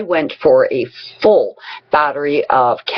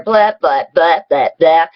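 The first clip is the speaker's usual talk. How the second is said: dismissive imitation of someone yammering on; mocking blather. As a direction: rattle off mock nonsense chatter. Mimics partner droning on.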